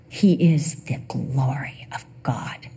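soft, low, reverent near-whisper; low and very soft, marvelling; hushed awe. Real, not acted. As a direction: let it sink to an awed hush. Awed aside after quoting God.

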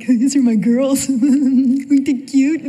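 loud, gushing show-off with giggles; giddy. Retold or imitated. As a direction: re-enact giddy pride, laughing as you go. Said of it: "I get giddy".

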